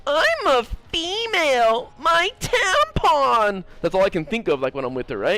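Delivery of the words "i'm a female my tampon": much higher, slow mock-girly whine, stretching tampon; mocking girl. Mocking his friend as helpless girl, high whiny parody. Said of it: "I don't expect much from her except to".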